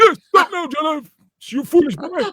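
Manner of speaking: very high, loud mock scolding; hammed-up imitation of a woman telling him off; Jeanette impression. Host mimicking co-host scolding him as a foolish boy, hammed-up impression. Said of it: "Is that what I sound like?".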